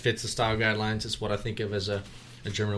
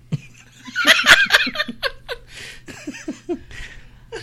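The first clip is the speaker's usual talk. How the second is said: loud laugh; 8 dB louder than usual. Real, not acted. Laughing at own accent.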